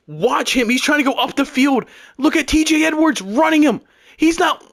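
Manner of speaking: high, urgent outraged protest; indignant shouting. Real, not acted. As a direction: point out the injustice at a yell. Protesting the play to viewers.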